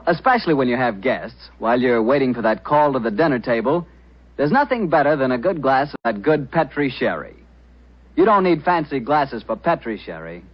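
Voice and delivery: ordinary delivery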